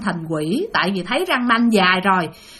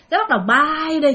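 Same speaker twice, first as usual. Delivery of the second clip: usual speaking voice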